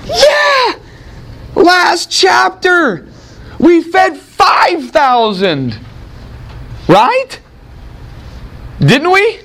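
high, drawn-out exclamations with rising checks; mock incredulity. Teacher ribbing the disciples' forgetfulness about the five thousand, high mock incredulity.

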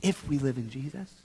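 very soft, low and hushed; as soft as possible. Shown as a demonstration. Leader cues children's soft response, very hushed. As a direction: drop right down to a hush. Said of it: "As soft as you can".

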